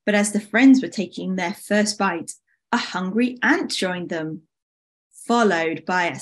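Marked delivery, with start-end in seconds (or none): none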